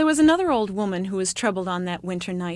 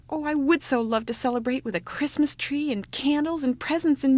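much higher, breathless yes yes; eager little child; 7 semitones higher than usual. Acted. Youngest child pleading with his mother, eager and high. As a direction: beg like a thrilled small boy.

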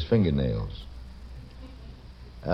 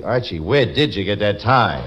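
louder, punched-out angry question; 5 dB louder than usual. Shown as a demonstration. Host demonstrating a voice exercise to the audience, angry. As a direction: demand it in mock anger, hitting each word hard. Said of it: "then you say it angrily".

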